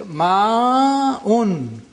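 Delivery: "ma'un" as maaaa-un, Quranic recitation length; extra lengthening. Shown as a demonstration. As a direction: stretch the first vowel of "ma'un" very long, then bring out the hamza. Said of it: "in the Quranic Arabic we say".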